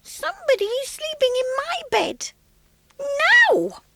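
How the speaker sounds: very high squeak bursting into a loud squeal on Now; a cub shrieking to wake the girl; small squeaky baby voice. Acted. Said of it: "small, squeaky baby voice".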